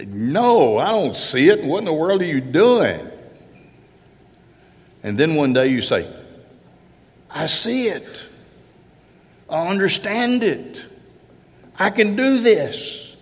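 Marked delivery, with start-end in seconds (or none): 0.1-3.1 loud, higher-pitched mock bafflement; exasperated student. Baffled student answers the algebra teacher, acted exasperation. 7.3-13.2 high-pitched, triumphant breakthrough; dawning realization. Acted. Algebra student one day gets it, acted excited discovery.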